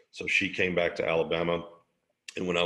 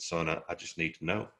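calm EMT; 3 semitones lower, 7 dB quieter than usual. Retold or imitated. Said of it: "He said".

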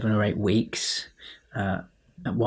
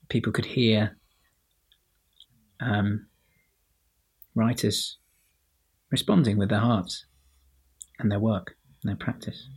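usual speaking voice

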